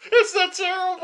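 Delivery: loud, very high squawked retort; incredulous outburst. Acted. Co-host disputes how terrible it is, incredulous outburst. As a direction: throw it back high and loud.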